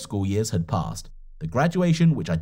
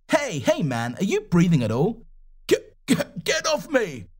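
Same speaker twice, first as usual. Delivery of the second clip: startled cry; 6 semitones higher than usual. Acted. Stranger pinned under him.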